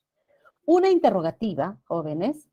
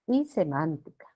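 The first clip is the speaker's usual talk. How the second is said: usual speaking voice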